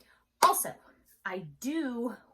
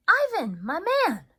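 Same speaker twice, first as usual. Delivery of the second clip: much higher and louder call of the name; 10 semitones higher, 10 dB louder than usual. Acted. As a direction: hail Ivan through the glass. Mac calls to the gorilla.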